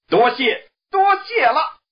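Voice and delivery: high, loud overjoyed monkey thanks; happy thanks. Acted. Monkey King overjoyed thanking the envoy, high loud glee. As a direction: shout your thanks with glee. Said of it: "悟空高兴地说".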